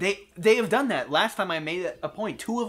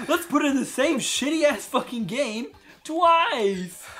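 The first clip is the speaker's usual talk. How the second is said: higher, drawn-out mocking quote; sarcastic put-on enthusiasm; mock developers; 8 semitones higher, slower than usual. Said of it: "They're like".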